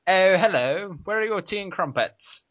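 put-on American faking a posh British accent. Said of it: "put a posh British accent on by going".

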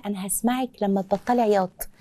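soft, low and calm. Shown as a demonstration. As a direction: drop your voice to settle a crying child. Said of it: "بوطي صوتي". (بصوت واطي وهادي)

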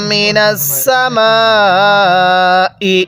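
মিনাস সামাই with the মি held very long and melodic; four-alif madd. Shown as a demonstration. Teacher chants মিনাস সামাই with a long madd. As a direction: stretch the long vowel for four counts, chanting slowly. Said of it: "চার আলিফ টান দিব".

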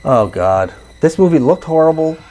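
ordinary delivery

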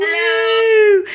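loud, high whoop; excited cheer. Real, not acted. Vlogger cheering as a friend joins the video, excited. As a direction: cheer loudly for your guest, high-pitched.

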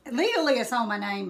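ordinary delivery, nothing marked

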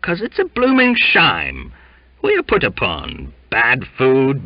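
high, loud Cockney rabble-rousing; loud indignant Cockney tirade; indignant rant. Acted. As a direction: whip up the crew in outrage. His altruistic indignation blazed.

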